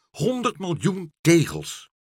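high, loud and drawn out; excited call. A narrator's reading. Dolly reports the tiles to meneer Pen, excited call. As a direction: proclaim shocking news slowly, high and loud. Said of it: "riep Dolly".

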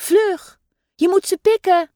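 high, pressing call; a mother gull urging the father to peck; urgent call. A narrator's reading. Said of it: "roept mama meeuw".

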